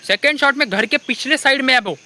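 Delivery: normal talking voice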